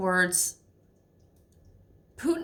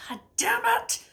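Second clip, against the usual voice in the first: exasperated Biden. Retold or imitated. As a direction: blurt it like a fed-up president. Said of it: "he's just like".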